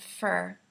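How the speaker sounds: for said as fur, quietly; for reduced to fur. Shown as a demonstration. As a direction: say fur with the er sound. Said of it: "almost like an E sound".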